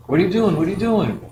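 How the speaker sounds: man demanding answers from someone picking plants; pushy stranger. Retold or imitated. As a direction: press him like a suspicious stranger. Stranger confronting him aggressively.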